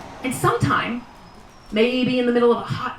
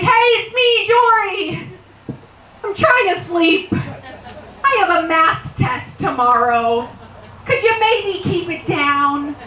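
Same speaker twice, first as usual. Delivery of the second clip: put-on kid yelling across the ocean; 6 semitones higher than usual. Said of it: "scream across the ocean, be like".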